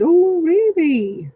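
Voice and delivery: high and slow, feigned astonishment; mock surprise. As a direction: play dumb, stretch it out in fake wonder. Fake surprise to scammer.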